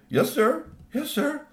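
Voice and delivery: high, rising timid reply; meek obedience. Acted. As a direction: squeak your compliance to the boss. Scared employees answer angry boss.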